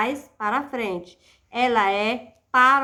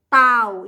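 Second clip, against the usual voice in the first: "tal" punched out louder; stressed syllable alone; 7 dB louder than usual. Shown as a demonstration. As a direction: hit "tal" hard on its own. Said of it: "é a sílaba tônica". ("tal" isolado, bem forte)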